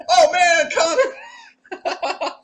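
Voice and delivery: very high, laughing; high laughing tease. Real, not acted. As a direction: squeal his name laughing. Teasing friend, laughing.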